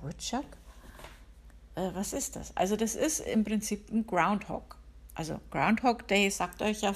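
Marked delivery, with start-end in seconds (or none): 0.0-2.6 softer, mildly baffled query, rising on Woodchuck; puzzled question. Retold or imitated. Wife replying to husband about an unknown animal, puzzled. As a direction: re-enact a touch of bewilderment, quietly.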